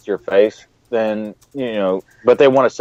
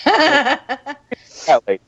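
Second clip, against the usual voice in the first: cat lady; 14 semitones higher, much slower than usual. Retold or imitated. Mocking the neighbor's label.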